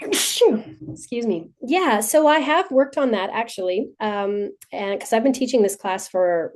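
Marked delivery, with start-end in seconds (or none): 0.0-0.9 real sneeze, sudden and sharp; sneezing. Real, not acted. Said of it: "I'm gonna sneeze".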